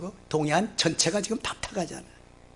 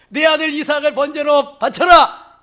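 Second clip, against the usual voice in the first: much higher, louder divine command; commanding God; 9 semitones higher, 8 dB louder than usual. Acted. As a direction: thunder the command from above. God's command to Abraham quoted. (하나님이 아브라함에게 명령하듯 높고 크게)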